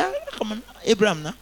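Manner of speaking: high, coaxing mock plea.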